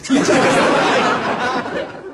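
laughing. Real, not acted. Laughs at own joke.

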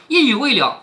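ordinary delivery, nothing marked

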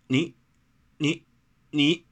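indignant, isolated jabs of sound; too cross to finish the sentence; speechless with anger. A narrator's reading. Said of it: "气得一时耳朵都歪了".

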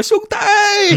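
high-pitched acted wail; hammed-up wail from someone rolling in pain; pained reproach. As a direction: cry out to your brother in mock agony. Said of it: "说埋怨老三".

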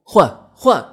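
high, loud yell; explosive, high-pitched shout; furious roar. A narrator's reading. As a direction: roar the demand skyward. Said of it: "抬头怒吼".

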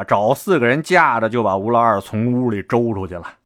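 ordinary delivery, nothing marked

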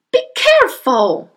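high, loud warning shriek; shrieking mother. Acted. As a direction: shriek it in alarm. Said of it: "shrieked the mother".

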